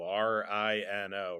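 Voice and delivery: usual speaking voice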